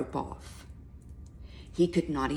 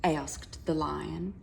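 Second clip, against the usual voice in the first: usual speaking voice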